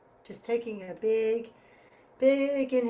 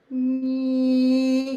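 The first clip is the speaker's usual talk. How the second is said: nee much louder, ringing in the nose; growing nasal nee; 9 dB louder than usual. Shown as a demonstration. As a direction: swell nee through the nose. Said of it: "getting louder over eight beats".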